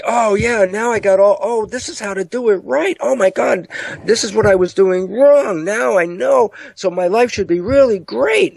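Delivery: high, gushing realization; mock revelation. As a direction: act out someone who suddenly gets it all. Acting someone having a joyful revelation.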